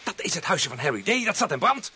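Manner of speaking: urgent, fast, high shouting; alarmed shout. Acted. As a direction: shout the alarm, the cottage is burning. Cottage on fire, alarm.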